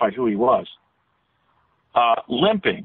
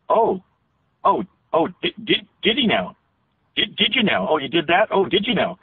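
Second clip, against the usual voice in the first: higher-pitched, sing-song Pennsylvania Dutch dialect; Pennsylvania Dutch lilt; 5 semitones higher than usual. Shown as a demonstration. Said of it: "There's kind of a little bit of a sing-song lilt in it".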